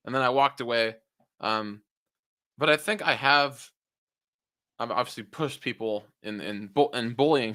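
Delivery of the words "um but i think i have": ordinary talk, nothing marked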